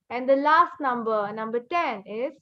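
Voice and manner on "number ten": ordinary delivery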